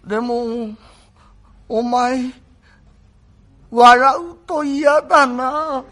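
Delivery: very slow, drawn-out, whimpering sick voice; feeble invalid. Acted. The bedridden young master weakly pleading with Kuma, slow and whimpering. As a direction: barely get the words out, frail and pitiful.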